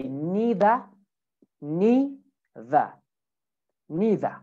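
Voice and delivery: British "neither", NEE-thuh; British pronunciation. Shown as a demonstration. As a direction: say "neither" with ee and no final R. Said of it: "in British".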